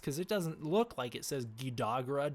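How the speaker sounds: usual speaking voice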